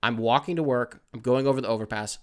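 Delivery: ordinary talk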